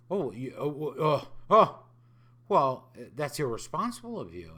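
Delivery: rising hammed-up "oh, oh, oh" into a loud scold; mock horror climbing to a loud cry; mock shocked gasp. Imitating seniors shocked that he skipped the vaccine, loud and hammed-up. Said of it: "And I said, "No".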